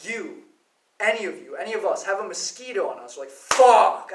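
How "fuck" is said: sudden loud swear; mock outburst. Someone bitten by a mosquito, sudden loud mock curse. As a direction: blurt it out at a mosquito bite.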